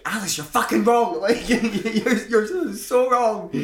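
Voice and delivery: mock scolding. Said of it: "where you're like".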